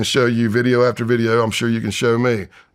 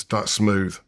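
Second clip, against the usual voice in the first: ordinary talk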